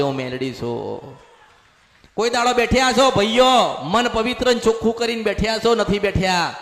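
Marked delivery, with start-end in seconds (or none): 0.0-1.2 a hint of a lower goddess voice, slightly hushed; goddess announcing herself. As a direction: lightly voice the goddess stepping forward. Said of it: "પેલી ચાવણ માતા મઠમાંથી બોલવા આવશે". (હળવો દેવીનો અવાજ, થોડો નીચો)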